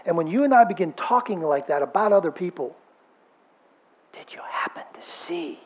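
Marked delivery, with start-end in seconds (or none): 4.1-5.6 unvoiced gossipy whisper; gossiping whisper. Churchgoer whispering gossip to a friend, hushed and secretive.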